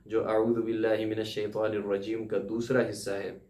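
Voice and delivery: ordinary talk, nothing marked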